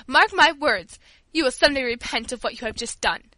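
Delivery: loud, indignant warning; acted outraged admonition; trembling with indignation. A narrator's reading. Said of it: "her voice trembling with indignation".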